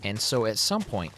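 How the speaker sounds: normal talking voice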